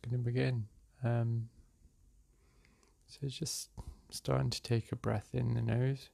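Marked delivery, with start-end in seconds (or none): 3.1-6.1 unhurried meditation-guide voice with long pauses; guided meditation. Real, not acted. Meditation guide leading listeners through breath and release, calm and unhurried. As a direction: guide them gently through each breath, leaving space between phrases.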